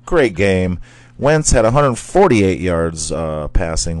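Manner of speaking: normal talking voice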